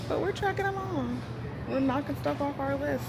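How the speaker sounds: sing-song cheer about progress; perky progress update; chirpy upbeat. Real, not acted. Upbeat high voice.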